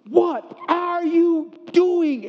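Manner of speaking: high, drawn-out outraged yell; retold scream. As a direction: scream it slowly, word by word.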